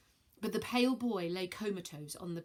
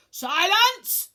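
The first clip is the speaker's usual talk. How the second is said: much higher, very loud shout; 12 semitones higher, 14 dB louder than usual. Acted. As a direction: silence the room at once. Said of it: "shouted Piaces".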